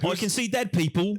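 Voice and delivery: high-pitched mock British accent. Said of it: "Well, you're British".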